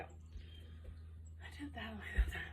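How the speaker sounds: slow whispered aside; whispering. Real, not acted. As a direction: whisper it slowly. Whispered aside mid exercise.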